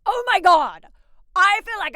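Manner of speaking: shocked. Real, not acted. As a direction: blurt it out in shock. Shocked reaction to scandal.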